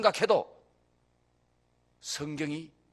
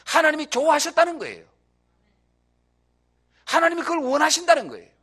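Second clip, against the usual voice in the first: loud, higher preaching build; preaching at full force; 3 semitones higher, 6 dB louder than usual. Acted. Pastor driving home that God likes loud prayer, loud. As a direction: press each phrase out loud to the crowd.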